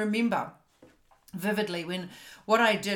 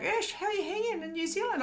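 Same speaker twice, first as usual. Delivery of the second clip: high-pitched, over-familiar pal greeting; imagine your new best friend hailing you; chummy coach impression; 11 semitones higher, 4 dB quieter than usual. Coach greeting his imagined bestie, high chummy mock voice. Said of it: "he's gonna be like".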